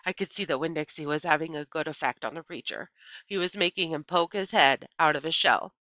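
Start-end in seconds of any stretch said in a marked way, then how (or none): none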